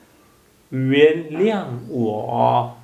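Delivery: each syllable stretched out slowly with its tone; drawn out so students can copy the tones; slow tone demo. Shown as a demonstration. Teacher models 原谅我 slowly for learners. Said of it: "please tone".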